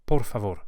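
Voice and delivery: "Por favor" with both r's lightly tapped by the tongue tip; tapped Spanish r. Shown as a demonstration. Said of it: "lightly flipped with the very tip of the tongue".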